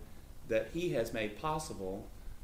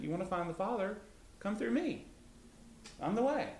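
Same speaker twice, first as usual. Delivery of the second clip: much higher, eager open invitation rising to the end; inviting Jesus; 10 semitones higher than usual. Retold or imitated. Jesus answering the disciples' question, high and eagerly welcoming. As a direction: voice Jesus beckoning warmly to come through him, pitched well up. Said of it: "No, he's saying".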